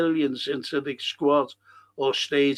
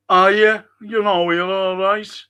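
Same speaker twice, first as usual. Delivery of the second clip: louder mock posh English accent; 3 semitones higher, 7 dB louder than usual. Mocking football pundits in a posh English accent. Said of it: "Fucking put on the accent".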